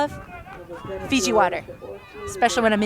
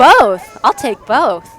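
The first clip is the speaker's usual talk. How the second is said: excited shout; 9 dB louder, much slower than usual. Real, not acted. Excited reply.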